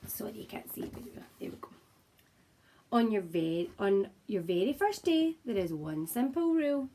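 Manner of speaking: usual speaking voice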